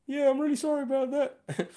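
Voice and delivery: high, small, laughing apology; meek heckler voice. Retold or imitated. Imagined heckler meekly apologising to the comedian, high and laughing. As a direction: squeak out a sheepish sorry, half laughing. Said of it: "he'd be like".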